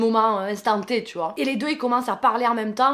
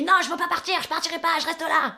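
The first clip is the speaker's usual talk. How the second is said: mock cry, much higher; woman crying out; 7 semitones higher, faster than usual. Woman refusing to leave the neighbour's flat: higher mock cry. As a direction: refuse it in a high pitched wail. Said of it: "Et elle crie".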